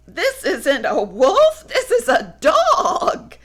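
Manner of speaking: high, giggly correction; relieved and amused; laughing relief. Acted. Woolly relieved.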